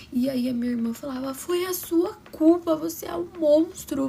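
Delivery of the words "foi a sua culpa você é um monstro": angry accusation. Retold or imitated. Sister accusing father.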